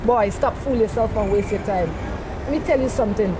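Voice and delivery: usual speaking voice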